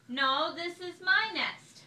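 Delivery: slow, loud, high, insisting on my; loud stubborn hen; loud claim. A narrator's reading. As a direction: push back loudly and slowly. Chicken claims nest.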